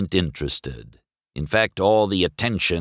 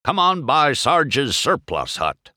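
higher, slow military character voice; barking old Jeep; 8 semitones higher than usual. A narrator's reading. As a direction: drawl it out like an old sergeant. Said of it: "barked the old Jeep".

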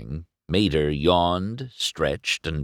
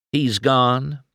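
raised, questioning pitch on "gone"; 6 semitones higher, 3 dB louder than usual. A narrator's reading. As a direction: ask it with a higher voice. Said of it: "Flo asked".

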